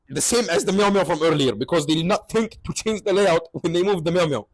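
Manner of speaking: usual speaking voice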